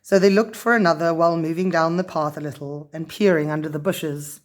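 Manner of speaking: ordinary delivery, nothing marked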